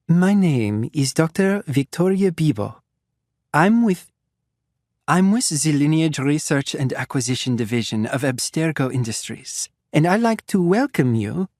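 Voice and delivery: polite doctor with a hint of a French lilt; slight French accent. Doctor apologizing to the captives, slight refined French accent. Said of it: "slightly French accent".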